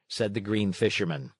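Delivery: ordinary delivery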